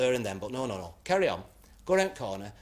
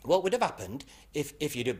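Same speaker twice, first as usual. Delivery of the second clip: put-on lilting Welsh accent, running the words together; 3 semitones higher than usual. Policeman putting a test question to driver, Welsh accent. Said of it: "In a Welsh sort of accent".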